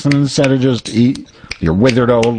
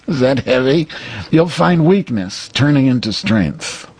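higher put-on psychic medium voice, wise and sage; Sylvia Browne voice; 5 semitones higher than usual. Reading Sylvia's wisdom to Cheryl in a higher mock-psychic voice. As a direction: intone it like a mock-solemn old psychic. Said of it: "I'll read Sylvia".

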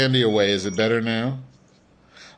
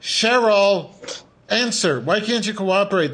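high-pitched exasperated pleading; exasperated demand; 7 semitones higher than usual. Real, not acted. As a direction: push her to play along, pitch raised. Host pleads for cooperation in bit.

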